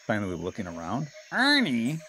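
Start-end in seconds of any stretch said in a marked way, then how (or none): 1.3-2.0 Bert crying out. A narrator's reading. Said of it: "he cried".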